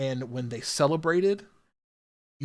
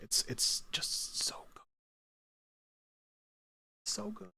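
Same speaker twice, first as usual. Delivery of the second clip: whisper; 7 semitones higher, 11 dB quieter than usual. Real, not acted. Gushing about the show.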